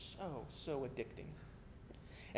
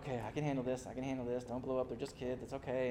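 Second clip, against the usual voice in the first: calming himself; 5 semitones lower, 6 dB quieter, faster than usual. Retold or imitated. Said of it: "So I'm like".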